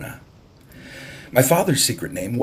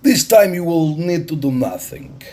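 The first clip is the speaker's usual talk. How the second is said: higher, louder, commanding stranger; bold Russian captain; 7 semitones higher, 4 dB louder than usual. A narrator's reading. Russian captain to the island chief, higher and louder. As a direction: announce it like a man who owns the harbor. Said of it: "Captain Orlov said".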